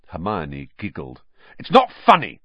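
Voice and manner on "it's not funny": fierce retort, higher and louder; indignant fierce protest. A narrator's reading. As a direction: snap back fiercely, voice jumping up. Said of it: "said Ron fiercely".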